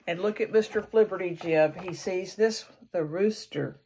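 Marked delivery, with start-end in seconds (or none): none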